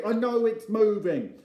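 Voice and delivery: high, loud, harassed retort; shouting back. Acted. Dad hanging on, answering Joe, harassed shouting. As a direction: yell it back impatiently.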